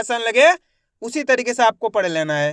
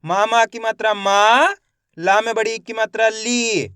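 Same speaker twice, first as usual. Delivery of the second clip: slow demo; much faster than usual. Shown as a demonstration. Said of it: "देखो किस तरीके से है".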